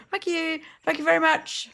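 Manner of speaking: high, singsong quick thanks; said so quickly. Retold or imitated. Coworker seeing off tourists, high quick thanks. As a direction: imitate a chirpy thanks slurred into a swear. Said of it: "And in so quickly".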